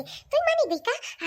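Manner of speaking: amazed. Acted. Amazed the parents agreed.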